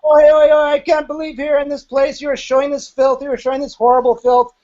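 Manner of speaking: high-pitched imitation of an outraged guru; mock scandalised yelling; ballistic mock rant. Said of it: "ninety seconds of screaming and yelling".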